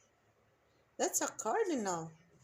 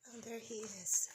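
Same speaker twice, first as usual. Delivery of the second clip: ordinary talk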